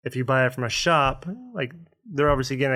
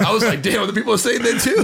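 laughing; 8 semitones higher, 4 dB louder than usual. Real, not acted. Laughing while retelling.

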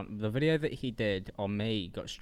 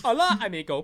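high, louder put-on Spanish greeting; mock Spanish accent, higher and louder; Spanish droid; 8 semitones higher, 6 dB louder than usual. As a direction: play a jokey Spanish robot, pitched up. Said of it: "where you're a Spanish droid".

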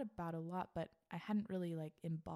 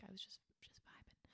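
breathy near-whisper, drifting off; 16 dB quieter than usual. Retold or imitated. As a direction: let it fall to a hush. Dreamy recap of solo dancing.

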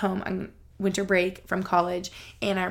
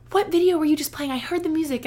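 higher, louder eager question; excited roommate; 8 semitones higher, 4 dB louder than usual. Retold or imitated. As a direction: burst in curious about the music. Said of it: "And she's like".

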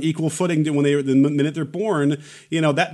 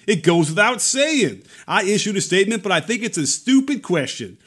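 put-on Mississippi accent; 6 semitones higher than usual. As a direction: imitate a Mississippi senator's accent. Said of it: "can I do my Mississippi, uh, my Mississippi accent?".

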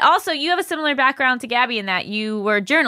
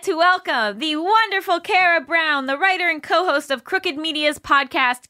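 bright, higher show-host intro, slowed; nineties kids TV host; 7 semitones higher, 3 dB louder than usual. Acted. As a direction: welcome the guest like a Nickelodeon host. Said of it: "that intro was giving me, like, Nickelodeon nineteen ninety-eight vibes".

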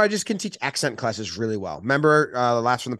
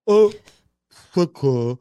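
French accent as if never taught to talk; half-formed French "au", pause, "secours"; dumbed-down French accent; 5 semitones higher, 5 dB louder, slower than usual. Shown as a demonstration. Host mocking French while teaching the accent, dumbed-down French accent. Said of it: "pretend you're dumb and you never learned".

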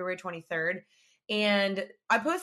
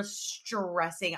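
"stressing" said slowly with a long, held opening; drawn-out STRESSING; 2 semitones lower, 4 dB quieter than usual. Real, not acted. Recalling the wait at the bank, "stressing" drawn out. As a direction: stretch the start of "stressing" long.

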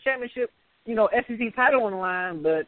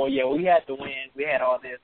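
low, mock excuse-making coach; mocking Nick Saban; 7 semitones lower than usual. Mocking Saban's excuse to his friends, low and dismissive. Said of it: "blame somebody else".

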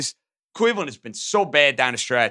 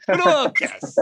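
silly put-on voice; 9 semitones higher than usual. Host repeats line in put-on voice.

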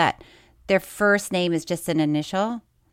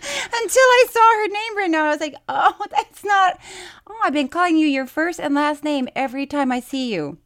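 laughing; 7 semitones higher than usual. Real, not acted. Laughing through the retelling.

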